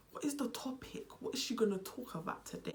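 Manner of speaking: unvoiced conspiratorial whisper. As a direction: whisper it like a curious viewer. Viewers wondering about the topic.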